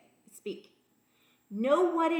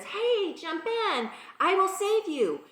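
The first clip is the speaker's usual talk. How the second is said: higher-pitched mock call to someone stranded; 7 semitones higher, slower than usual. Rowboat man to man on the roof, calling out. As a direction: call out an eager rescue offer.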